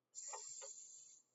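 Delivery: "S-" as a long hissed s, no voice; s sound. Shown as a demonstration. Teacher shows children the s sound, a soft hiss. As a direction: teeth closed, tongue on the ridge, just hiss "S-". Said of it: "close your teeth down and say".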